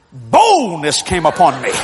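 slow, booming preacher-style announcement; grand declaration. Retold or imitated. Boy suddenly bold behind mama's rifle, declaiming. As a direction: stretch out the first word grandly.

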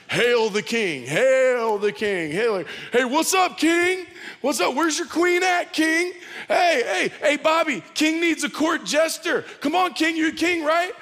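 high, loud jeering taunt; loud high mockery of a soldier crowd; mocking soldiers. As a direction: sneer at the beaten king like a cruel crowd. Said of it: "Mocking him".

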